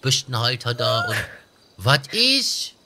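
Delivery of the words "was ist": higher and louder, fed-up demand; exasperated shout. Acted. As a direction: bark the question out in exasperation. Presses crying woman again. (genervt laut nachfragen)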